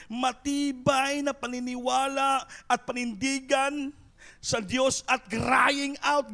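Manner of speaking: full-voiced pulpit exhortation; fervent preaching. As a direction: preach it with growing fire. Preacher builds to fervent climax.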